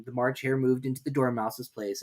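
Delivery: usual speaking voice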